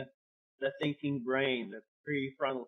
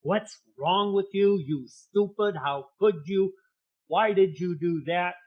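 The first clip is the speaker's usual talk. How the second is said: mock harsh self-criticism, a bit louder and higher; angry inner voice; 3 semitones higher, 6 dB louder than usual. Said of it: "had that angry voice on the inside going".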